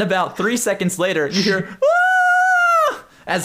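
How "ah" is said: very high, loud terrified scream. Acted. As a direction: shriek in terror. Klaus screams fleeing monster.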